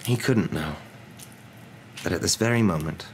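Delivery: ordinary delivery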